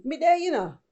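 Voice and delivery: Jamaican patois phrase, said fast; Jamaican accent. Shown as a demonstration. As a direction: run it together like one word. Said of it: "So in case you're wondering".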